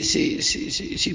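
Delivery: whispered yes yes; whispering. Acted. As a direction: breathe it out.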